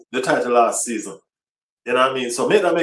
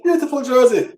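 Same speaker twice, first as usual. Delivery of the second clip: higher, gushing praise; admiring; 10 semitones higher than usual. Acted. Fan gushing over the new jersey, admiring.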